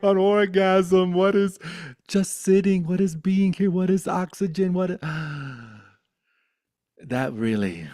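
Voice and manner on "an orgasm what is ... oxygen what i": high, loud frantic questioning; mock freak-out. Freaking out at having a body, high frantic shouting. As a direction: wail each question in hammed-up bewilderment.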